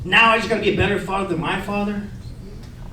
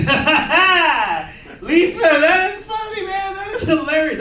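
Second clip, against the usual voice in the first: high, drawn-out forced laughter and praise; exaggerated mock amusement; 8 semitones higher, slower than usual. Laughing along with Lisa in the break room, exaggerated amusement. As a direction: overplay how funny it is, pitched way up. Joining coworkers' mockery with acted laugh.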